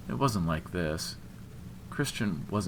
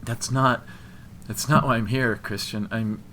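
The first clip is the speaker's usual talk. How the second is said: halting, raised-pitch protest; struggling to speak; 4 semitones higher, 5 dB louder than usual. A narrator's reading. Woman backed into a hallway explaining herself, halting protest. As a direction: stumble and restart, trying to explain. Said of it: "she tried to get out".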